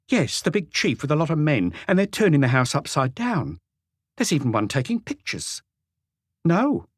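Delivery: a wife grumbling to her husband on the phone; housewife on phone. A narrator's reading. Renée phoning her husband.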